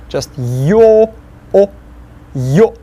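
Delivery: yo clipped short, no oo at the end; clean yo. Shown as a demonstration. Teacher modelling the Russian letter yo. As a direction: cut yo off with no glide. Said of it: "That's how it sounds".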